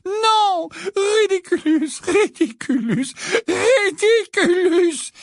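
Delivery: very high, loud, frantic spell-casting; desperate wailing. A narrator's reading. Missus Wesley fights the visions, desperate wail. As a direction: wail the spell over and over, stretching the last. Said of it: "se lamenta Missus Wesley".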